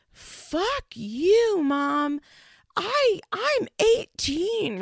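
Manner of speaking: high, loud bratty yell, slowing on eighteen; hammed-up teen screaming at mom; mock teen tantrum. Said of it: "She's like".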